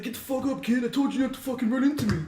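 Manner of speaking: high, fast imitated scolding; screaming. Crackhead on top of the knocked-down kid, mock screaming. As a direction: rattle it off high, a retold angry rant without full volume. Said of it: "screaming at me".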